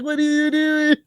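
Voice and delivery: very high-pitched retold exclamation; exasperated disbelief. Host re-enacting confronting his overfed dog, exasperated. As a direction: squeak it out in mock outrage at the dog.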